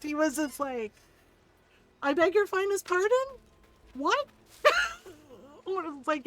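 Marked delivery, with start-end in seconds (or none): 2.0-4.3 high, loud mock disbelief; imitating shock. Ron overhearing the video, shocked disbelief. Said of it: "Ron was so shocked". 4.6-5.1 high, loud burst of laughter. Real, not acted. Cracking up at the shocked reaction, high laugh.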